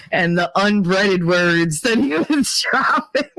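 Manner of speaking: laughing while talking; real laughter. Real, not acted. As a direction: crack up before you can finish. Cracks up at edited-out words.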